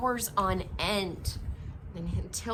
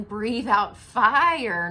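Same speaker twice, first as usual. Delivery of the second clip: louder, slow commanding order; slow loud teacher command; firm instruction; 10 dB louder, much slower than usual. Acted. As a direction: spell out the lesson. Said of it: "said Madam Dragon".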